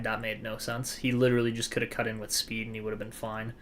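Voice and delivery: usual speaking voice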